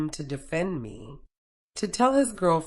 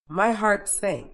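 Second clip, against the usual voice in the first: plain reading voice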